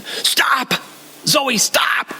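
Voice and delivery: sharp high yell of stop; daughter's yell. Retold or imitated. Daughter yelling at granddaughter near a passing car, sharp high yell. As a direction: yell stop at the child crossing the street. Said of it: "Jenny yells at her all of a sudden".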